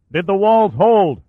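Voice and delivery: high, loud, slow yell into the dust. Acted. Shaw yelling down the shaft to Caldweiler, loud. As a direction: shout each word down into the haze. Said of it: "Shaw yelled".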